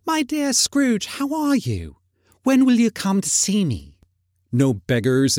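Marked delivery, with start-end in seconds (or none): 0.1-4.0 high-pitched glad greeting; gladsome greeting. A narrator's reading. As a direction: greet a dear friend gladly in a high voice. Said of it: "with gladsome looks".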